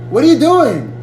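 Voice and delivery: high, loud mock girl voice; hammed-up eager girlfriend calling; imitating the girlfriend. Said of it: "His girl comes".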